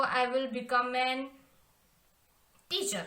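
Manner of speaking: slow dictation. Shown as a demonstration. Teacher dictating English sentence.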